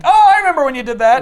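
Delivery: high, loud and fast mock recognition; excited sarcastic remark; mock excited recall. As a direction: blurt it out gleefully. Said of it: "And I'm like".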